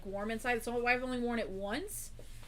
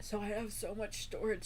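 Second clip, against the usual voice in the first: ordinary talk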